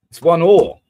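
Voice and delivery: loud, high score announcement; real burst of football excitement; excited goal shout. Real, not acted. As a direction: shout the equaliser out. Reacting to an equalising goal.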